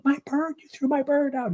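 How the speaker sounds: very high, shrill-pitched cry of distress; a frantic lady who just lost her pet; mock-hysterical woman wailing about her bird; panicked woman. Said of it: "The woman's in a panic".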